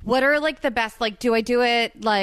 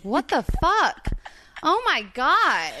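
high, slow astonished gasp; shocked exclamation; 7 semitones higher, 3 dB louder, slower than usual. Retold or imitated. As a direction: react like you just saw something wild. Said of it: "And I was like".

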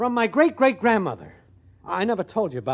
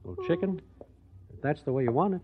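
hurt, quiet goodbye; 4 semitones lower, 12 dB quieter, faster than usual. Acted.

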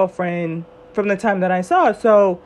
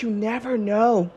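ordinary talk, nothing marked

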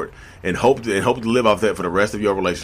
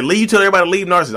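mock critic; 7 semitones higher, much faster than usual. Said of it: "I don't tell everybody".

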